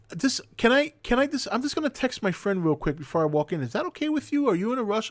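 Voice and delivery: high, sweet mock girl voice asking politely; lightly voiced tipsy passenger, sugary and hesitant; imitating drunk woman. Drunk passenger asks to text.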